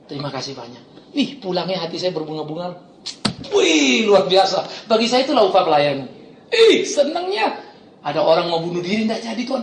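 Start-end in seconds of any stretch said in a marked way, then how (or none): 0.1-1.1 very soft heartfelt thanks. Retold or imitated. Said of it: "baru sekali ini saya ketemu Bapak". 6.5-7.5 high, slow gleeful laugh; delighted. Real, not acted. Delighted exclamation.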